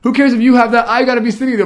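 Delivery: loud, high-pitched imitation of a whining sister; embarrassed sibling complaining at full pitch; mock whining. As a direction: protest in a hammed-up whine. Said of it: "she just whined the whole way".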